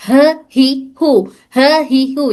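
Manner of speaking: ह, ही, हु breathed lightly from the lowest part of the throat. Shown as a demonstration. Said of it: "ये ह हलक के निचले हिस्से से अदा हो रहा".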